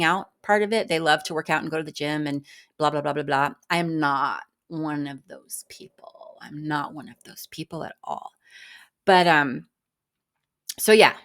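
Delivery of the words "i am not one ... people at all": hushed admission. Real, not acted. Woman admitting she is no gym lover, hushed. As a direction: drop to a whisper to confess it.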